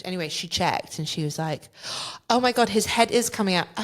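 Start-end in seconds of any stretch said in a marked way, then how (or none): 2.3-3.7 panicked. Retold or imitated. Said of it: "And then she panicked".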